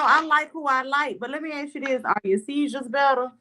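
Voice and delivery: usual speaking voice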